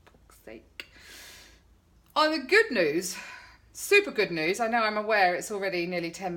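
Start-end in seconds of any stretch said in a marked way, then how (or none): 0.0-0.9 exasperated whisper. Retold or imitated. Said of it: "I'm just going".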